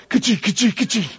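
sharp mouth imitation of a nail gun firing; imitated nail gun bursts; nail gun noise. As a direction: fire off the nail gun sounds.

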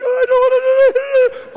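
terrified wail. Retold or imitated. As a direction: wail it like you're crying with fear. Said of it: "sweating and crying".

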